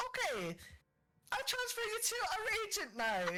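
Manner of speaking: robot line broken by laughter; high, fast and giggling; laughing robot voice. Retold or imitated. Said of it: "It goes".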